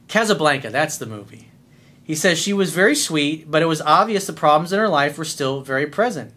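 ordinary talk, nothing marked

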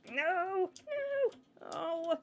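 high, drawn-out cry of defeat; dismayed wail. Real, not acted. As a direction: wail as it all goes wrong. Failing in the game.

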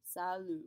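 Salut said salu with no T; silent final T. Shown as a demonstration. Teacher modeling salut for students. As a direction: leave the T silent. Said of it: "ignore it when we say it".

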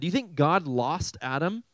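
ordinary talk

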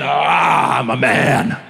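drawn-out cry dropping low; mock manly roar. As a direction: play up the he-man triumph. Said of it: "Just feeling like a man".

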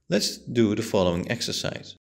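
usual speaking voice

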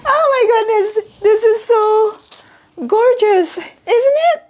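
high-pitched, real gushing; overwhelmed delight. Real, not acted. Admiring the shoes for viewers, overwhelmed delight. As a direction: coo in high, overjoyed amazement. Gushes over gift shoes.

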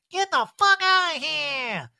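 high, loud, stretched-out holler; hammed-up drunken bellow; mock drunk yelling. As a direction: draw out the angry order as if hammered. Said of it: "hammered, is like".

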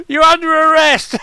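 sudden high, very loud yell; mock police shout. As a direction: burst in yelling like an arresting officer. Officer bursts in on the whisperer.

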